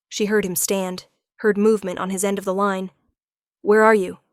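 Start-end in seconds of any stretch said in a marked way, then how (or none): 3.6-4.2 sudden loud urgent question. Acted. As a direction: demand it at once. He jumps up, urgent.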